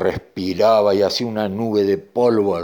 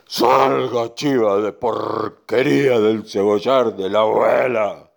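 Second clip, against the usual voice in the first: slow, drawn-out command; bull ordering goat out; 4 dB louder, slower than usual. Acted. Bull ordering the goat out of the onion patch, slow command. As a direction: stretch the order out forcefully. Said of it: "le dijo el toro".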